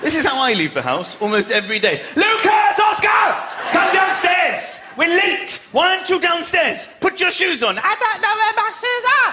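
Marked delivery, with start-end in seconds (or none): 2.2-7.8 put-on yelling at kids. Dad yelling at Lucas and Oscar to come down. 7.8-9.3 put-on high little kid voice; whiny child. Son answering dad, high child voice.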